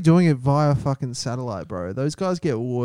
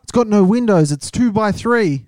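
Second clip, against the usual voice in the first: joining the rant; 6 semitones higher, 4 dB louder than usual. Real, not acted.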